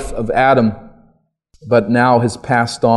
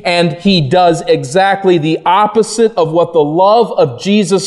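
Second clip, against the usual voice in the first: higher and louder, driving the point home; preaching at full force; 7 semitones higher, 3 dB louder than usual. Acted. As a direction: thunder the rebuke of Adam from the pulpit.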